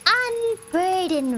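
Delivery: "Unburden" said slowly and deliberately; drawn-out word. Acted. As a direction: stretch "Unburden" out, falling at the end. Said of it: "What's unburden mean?".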